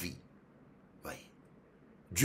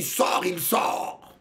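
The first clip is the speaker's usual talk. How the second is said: higher, drawn-out order; imitating a showy exorcist; boastful command; 7 semitones higher, much slower than usual. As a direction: call it out like a showman. Boastful pastor demonstrates his command.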